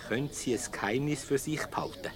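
flüsternd. Acted. Verschwörerisches Geheimnis, leise geantwortet.

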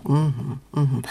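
low, relaxed hum of acknowledgement; relatively low mhm. Shown as a demonstration. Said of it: "so dieses relativ tiefe".

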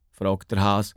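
usual speaking voice

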